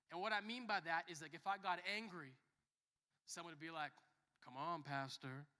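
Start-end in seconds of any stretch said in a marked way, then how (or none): 4.4-5.5 slow, low, mocking reproach; derogatory. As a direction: imitate someone chiding with the title, drawn out. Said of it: "in, like, a derogatory way".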